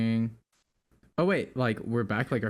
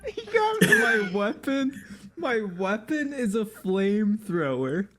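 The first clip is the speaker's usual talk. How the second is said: high, giggly voice; laughing; 7 semitones higher than usual. Real, not acted. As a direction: still laughing while talking. Still laughing after giggle fit.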